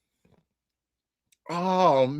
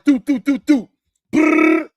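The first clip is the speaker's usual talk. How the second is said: loud high mouth gunfire; gunfire imitation; 7 semitones higher, 7 dB louder than usual. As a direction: rattle it off like a machine gun. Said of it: "get on stage like".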